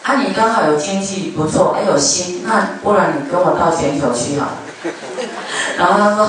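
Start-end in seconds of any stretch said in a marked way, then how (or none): 4.6-5.7 soft laugh. Real, not acted. Laughs at own joke.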